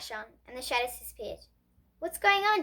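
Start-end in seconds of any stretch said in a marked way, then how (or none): 2.0-2.6 higher, mildly startled question; the lights suddenly came on; cried. A narrator's reading. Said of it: "Dion cried".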